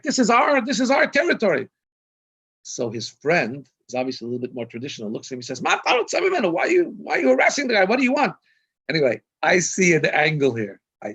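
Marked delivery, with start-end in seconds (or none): 0.0-1.7 high, loud territorial outburst, imitated; irate screaming. Israeli shopper retold claiming his territory, screaming irately. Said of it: "starts screaming at me". 5.6-8.3 high, loud mock scolding; indignant retort. Friend retold defending the stranger from harassment, indignant.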